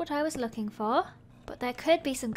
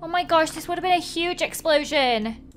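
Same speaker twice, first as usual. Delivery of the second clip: usual speaking voice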